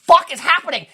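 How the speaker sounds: high, loud, incredulous yell; loud, high-pitched exasperation at utter nonsense; exasperated outburst. Retold or imitated. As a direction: burst out in baffled outrage. Exasperated reaction to the film.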